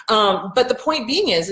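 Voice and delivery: ordinary delivery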